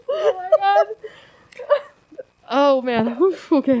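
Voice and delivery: normal talking voice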